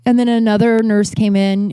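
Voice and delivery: ordinary delivery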